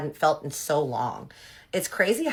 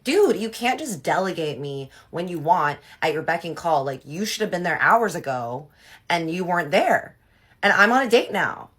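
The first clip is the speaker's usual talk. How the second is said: retold indignant telling-off, pushed up and louder; mock-exasperated pushback in an argument; 3 semitones higher, 7 dB louder than usual. Telling her ex off for summoning her mid-date, indignant.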